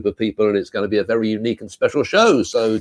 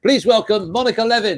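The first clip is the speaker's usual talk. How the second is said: announcing guest; 10 semitones higher than usual. Real, not acted. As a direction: bring her on like an announcer.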